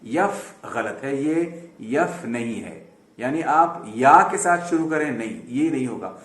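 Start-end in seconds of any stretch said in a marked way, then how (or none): 0.0-2.9 यफ said slowly with a ya onset as the mistake; wrong yeff. Shown as a demonstration. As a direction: demo the wrong यफ, drawn out. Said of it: "आप इसको यफ ना कहें". (गलत "यफ" उच्चारण, धीरे)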